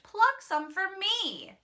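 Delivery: slow, high wheedling plea; begging wolf. A narrator's reading. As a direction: coax them like a sly wolf. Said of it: "the wolf begged".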